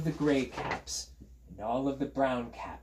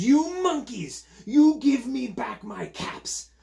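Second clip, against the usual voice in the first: high, loud, slow scolding; storybook outrage, pitched way up; angry peddler; 12 semitones higher, 7 dB louder, slower than usual. A narrator's reading. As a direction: demand it loudly, stretching each word. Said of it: "this made the peddler angry".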